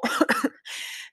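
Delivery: choking cough into the mic; sudden choking cough. Real, not acted. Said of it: "je m'étouffe dans mon micro".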